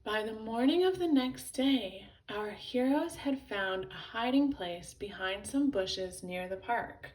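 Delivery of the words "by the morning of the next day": ordinary delivery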